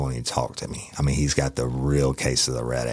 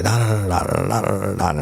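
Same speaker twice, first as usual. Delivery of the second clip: furious filler; 6 semitones higher than usual. Retold or imitated. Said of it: "he was furious".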